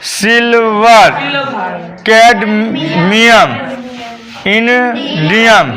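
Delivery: slow, stretched-out element names; drawn-out recitation. Shown as a demonstration. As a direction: call out each name slowly for the class. Calling out elements for class to learn. (धीरे-धीरे, खींचकर बोलना)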